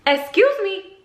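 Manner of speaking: very high-pitched, indignant question; bristle, pitch leaping up; affronted disbelief. Retold or imitated. Told by the cop to go back inside, affronted.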